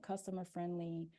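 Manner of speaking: normal talking voice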